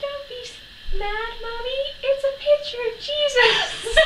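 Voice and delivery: put-on very high, pleading little boy voice; small child. Little brother soothing his angry mum, sweet child voice. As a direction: plead sweetly like a four year old. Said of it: "George was like".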